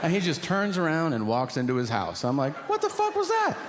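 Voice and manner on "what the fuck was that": very high, shocked squeal of confusion; stunned disbelief. Retold or imitated. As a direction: react as if something baffling just happened. Shocked after the senator leaves.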